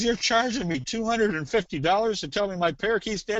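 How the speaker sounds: high-pitched indignant disbelief, acted; mock indignation. Pet owner challenging vet over the bill, mock indignation. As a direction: protest the bill in comic outrage.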